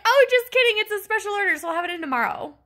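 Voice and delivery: high, loud start easing down; sing-song mockery of staff backtracking; mock-breezy staff. Mocking pharmacy staff's change of story.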